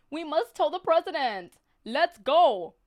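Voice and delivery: a goose honks the alarm; high, loud goose cry; honking goose. A narrator's reading. Goose honking the alarm to her friends. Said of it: "honked Goosey Lucy".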